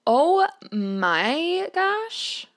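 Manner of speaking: drawn-out high, slow gush; slow high delighted gush. Real, not acted. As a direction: melt over the photo. Gushing at cute photo.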